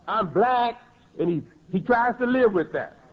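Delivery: ordinary delivery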